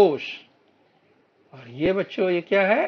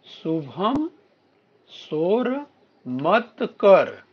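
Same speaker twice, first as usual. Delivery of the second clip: each word drawn out for pupils to follow; slow word-by-word reading; 3 semitones higher, 4 dB louder, much slower than usual. Shown as a demonstration. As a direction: read it out slowly, word by word, like a teacher. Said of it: "आगे पढ़िए". (धीरे-धीरे, एक-एक शब्द पढ़कर)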